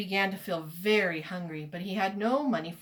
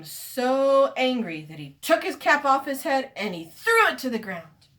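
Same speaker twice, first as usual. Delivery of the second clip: worked-up narration; 5 semitones higher, 8 dB louder than usual. A narrator's reading. Narrator acts out the peddler's fury.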